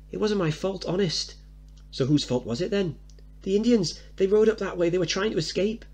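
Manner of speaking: put-on high little boy calling his mum; a kid glad to see his mother; child calling out to his mum; excited young boy. Mickey voiced as child.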